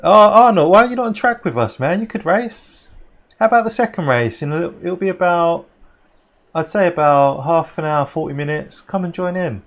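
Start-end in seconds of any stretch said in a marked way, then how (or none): 0.0-4.4 excited plea. Real, not acted. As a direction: urge him to come race. Calling a friend to join.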